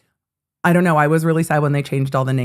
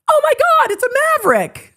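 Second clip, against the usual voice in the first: high-pitched, loud squeal of giddy excitement; 4 dB louder than usual. Retold or imitated. Telling about spotting a Maverick gas station, giddy shriek. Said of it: "giddily went".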